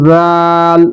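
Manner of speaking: রাল said lightly, with the a held long; gentle sustained রাল; light drawn-out ral. Shown as a demonstration. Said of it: "এটিও তিন আলিফ পরিমাণ টেনে পড়তে হয়".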